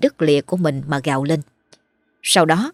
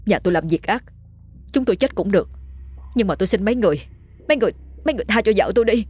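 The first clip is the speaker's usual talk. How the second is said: pleading, rushing fast and higher at the end; desperate begging; 3 semitones higher, much faster than usual. A narrator's reading. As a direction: beg for your wife, words tumbling out. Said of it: "khổ sở cầu xin". (van xin khổ sở, nói nhanh dần)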